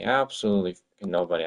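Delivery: ordinary talk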